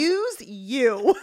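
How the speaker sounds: loud, slow, appalled rebuke; disgusted. Retold or imitated. Older sister accusing little brother in the waiting room, disgusted. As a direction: draw it out in outraged disbelief. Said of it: "I just looked disgusted".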